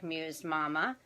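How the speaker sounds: ordinary delivery, nothing marked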